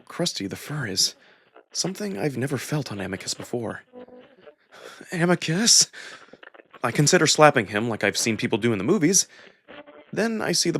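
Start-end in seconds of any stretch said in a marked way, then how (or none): 5.1-5.9 calling again. A narrator's reading. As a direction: call his name, trying to rouse him. Second try waking him.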